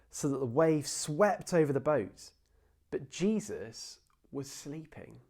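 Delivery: measured, dramatic Bible reading; scripture reading. Acted. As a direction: read the passage like a story to the church. Reads the scripture aloud.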